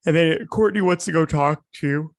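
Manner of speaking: talking through a yawn. Real, not acted. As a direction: yawn mid-sentence. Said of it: "I'm so sorry I'm yawning".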